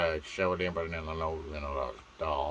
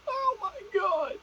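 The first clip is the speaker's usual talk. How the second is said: put-on squealing girly voice. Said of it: "and there's the".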